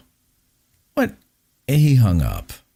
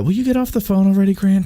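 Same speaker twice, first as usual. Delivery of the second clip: exasperated; 9 semitones higher than usual. Pretend. Mock exasperated at caller.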